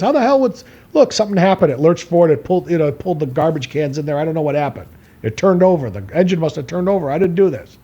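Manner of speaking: insistent bluffing. Retold or imitated. Son blaming the car to his dad, insistent mock bewilderment.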